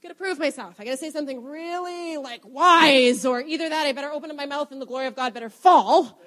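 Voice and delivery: acted self-pressure, mostly under the breath; uneasy inner pep talk; tense inward voice. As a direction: talk yourself into having to impress. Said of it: "when I would feel that tension".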